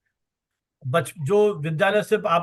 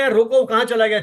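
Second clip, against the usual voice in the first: calling out; 2 semitones higher, 5 dB louder than usual. Acted. Acting out stopping a guest.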